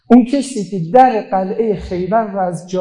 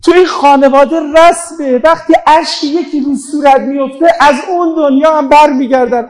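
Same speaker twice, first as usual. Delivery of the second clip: normal talking voice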